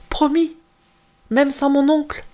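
very high, urgent plea; anxious pleading child. Acted. Girl begging her mother to promise she'll return, high and anxious. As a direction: beg for the promise.

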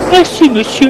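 high, polite woman's voice. Acted. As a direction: play the shaken lady thanking him.

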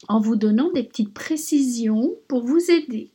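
ordinary delivery

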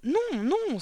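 high-pitched dismayed protest; crying out. A narrator's reading. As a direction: cry out to deny the charge. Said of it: "s'écria mademoiselle Candy".

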